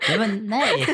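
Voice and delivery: high, loud protest through laughter; laughing denial. Real, not acted. Friend denies calling co-host fat, laughing denial. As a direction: burst out laughing while objecting.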